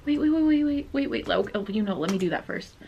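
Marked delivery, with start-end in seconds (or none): none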